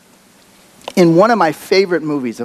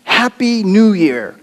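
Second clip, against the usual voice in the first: shouting; 7 semitones higher, 3 dB louder, slower than usual. Retold or imitated. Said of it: "he shouts out to him".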